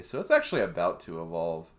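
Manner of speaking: normal talking voice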